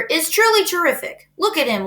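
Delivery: booming showman at the county fair; loudspeaker announcer. A narrator's reading. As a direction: proclaim it to the whole fairground. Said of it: "continued the loudspeaker".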